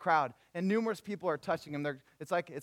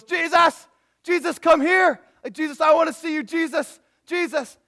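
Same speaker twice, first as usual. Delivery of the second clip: loud, high-pitched acted shouting; calling out; 12 semitones higher, 15 dB louder than usual. Blind beggar calling to Jesus passing by, calling out. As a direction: yell to someone far off in a crowd.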